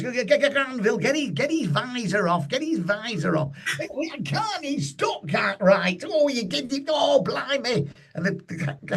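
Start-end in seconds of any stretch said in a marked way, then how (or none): 0.0-3.5 raised, stammering shopkeeper voice, each "get" tripping over the next; Arkwright impression. Arkwright frets at Granville to get the visor off, high stammering voice. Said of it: "and Arkwright goes". 3.8-7.9 lightly voiced flustered lad, much higher; Granville's reply. Granville tells Arkwright the visor is stuck, high flustered voice.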